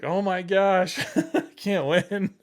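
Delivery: high, loud mock despair with a laugh; laughing exasperation. Student pilot discouraged by instructors, laughing exasperation. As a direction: cry out in comic defeat, breaking into laughter. Retold exasperation at another setback.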